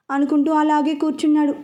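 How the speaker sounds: plain reading voice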